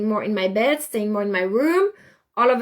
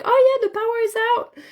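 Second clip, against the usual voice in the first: neighbor's reply; 13 semitones higher, 3 dB louder, faster than usual. Retold or imitated. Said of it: "She's like".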